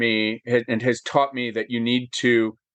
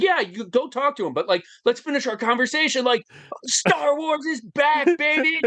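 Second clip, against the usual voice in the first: higher, eager voice for George Lucas, slowing on Star Wars; excited George Lucas; 12 semitones higher than usual. Retold or imitated. George Lucas cheering to the fan about Star Wars. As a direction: play him thrilled, drawing out Star Wars is back.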